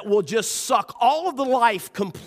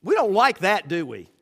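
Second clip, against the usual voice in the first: ordinary talk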